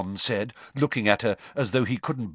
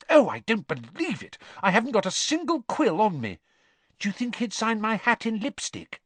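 gushing fan; 11 semitones higher than usual. Acted. Fan girls spotting a celebrity.

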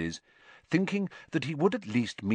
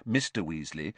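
ordinary delivery

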